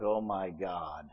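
low, slow, deflated groan of a bride; mock dread. Bride at the altar, teasing her groom: low slow mock despair. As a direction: sag into a weary mock sigh. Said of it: "And Colleen's like".

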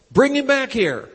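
high, loud sudden command; retold command to the ushers; commanding call. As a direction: call it out with unexpected authority. Sudden command heard in himself.

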